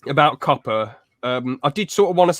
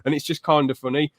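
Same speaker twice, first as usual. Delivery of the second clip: usual speaking voice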